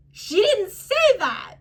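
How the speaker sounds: slow, high, stunned "she didn't say that"; incredulous inner voice. Retold or imitated. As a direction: disbelief you can't keep in. Inner voice, shocked at the agent.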